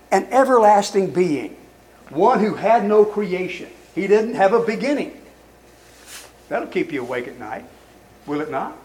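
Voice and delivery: normal talking voice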